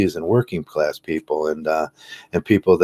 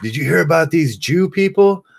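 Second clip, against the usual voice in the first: much higher, excited gossiping neighbor voice; mock gossiping neighbor; 11 semitones higher, 3 dB louder than usual. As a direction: run up and gossip like a nosy neighbor. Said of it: "he's like".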